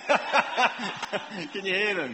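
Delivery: real laughter through the words; laughing. Real, not acted. Joking to congregation about their children, laughing.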